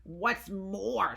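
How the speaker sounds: a mock snap from the Lorax; snapped. A narrator's reading. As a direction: bite the words off. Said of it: "snapped the Lorax".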